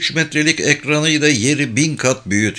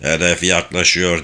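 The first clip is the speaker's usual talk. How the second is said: ordinary delivery, nothing marked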